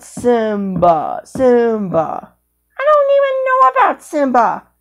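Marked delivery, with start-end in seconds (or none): 2.8-4.7 high squeaky puppet voice; squeaky character voice. Acted. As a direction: chirp it in a tiny cartoon voice.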